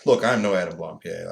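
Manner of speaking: ordinary talk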